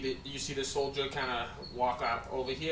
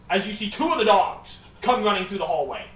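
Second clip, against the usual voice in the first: usual speaking voice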